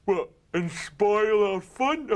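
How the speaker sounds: slow mate's voice. Retold or imitated. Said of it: "And they're like".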